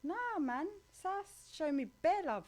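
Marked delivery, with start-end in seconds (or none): none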